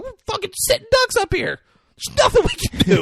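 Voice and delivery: very high, loud mock panic; freaking out. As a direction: squeal it like panicking performers. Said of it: "the girls and the balls are freaking out".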